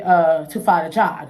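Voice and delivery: ordinary talk, nothing marked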